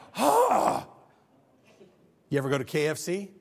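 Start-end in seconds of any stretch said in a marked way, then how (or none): 0.1-0.8 a much higher, startled "Huh."; mock bafflement. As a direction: act dumbfounded. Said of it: "I'm just like".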